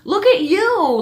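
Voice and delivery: high impressed gush; amazed. Retold or imitated. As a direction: marvel at the little girl. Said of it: "I'm just looking at her like".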